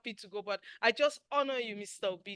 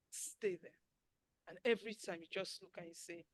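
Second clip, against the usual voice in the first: ordinary talk, nothing marked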